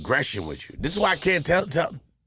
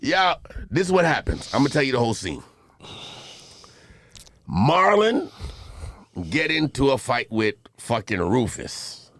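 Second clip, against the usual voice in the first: ordinary talk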